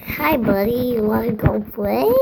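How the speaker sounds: put-on light baby voice, slow. Shown as a demonstration. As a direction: talk like a little kid. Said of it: "I'll use a baby voice like".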